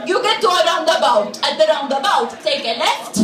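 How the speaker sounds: put-on blunt Maltese-accented directions; heavy Maltese accent; Maltese English. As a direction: imitate a Maltese local struggling with English. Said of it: "a Maltese person who doesn't speak English very well".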